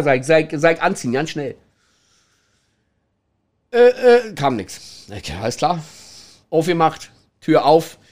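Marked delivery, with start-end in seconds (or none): none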